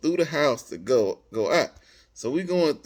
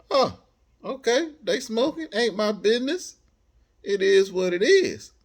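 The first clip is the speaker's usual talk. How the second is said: nonchalant shrug; 6 semitones higher than usual. Retold or imitated. Smells weed at the party.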